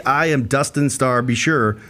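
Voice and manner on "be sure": ordinary talk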